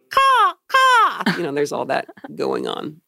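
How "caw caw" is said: bird caw. Retold or imitated. Mock bird cry after speech.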